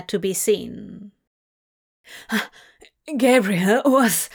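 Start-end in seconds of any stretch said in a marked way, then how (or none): 3.2-4.3 panicked, broken-off, breathless start. A narrator's reading. Crowley waking from a nightmare, breathless panic. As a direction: blurt it while catching breath. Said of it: "Crowley began breathlessly".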